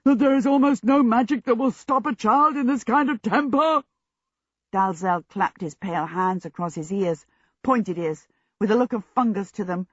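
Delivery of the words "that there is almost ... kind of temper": raised booming djinn voice, higher than usual; exasperated giant's roar; thundering. A narrator's reading. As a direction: thunder it out over the screaming. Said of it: "he thundered".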